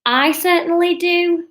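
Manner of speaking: high, slow northern daydreamer; dreamily emphatic, drawn out; dreamy. A narrator's reading. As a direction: drift off dreamily in a northern accent. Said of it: "said Catherine dreamily".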